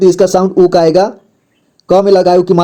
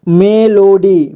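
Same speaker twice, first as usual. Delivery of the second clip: Melody stretched into three slow syllables; much slower than usual. Shown as a demonstration. Teacher breaking down melody for learners, slowly syllable by syllable. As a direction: sound out melody slowly, piece by piece. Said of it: "जोड़ जोड़ करके".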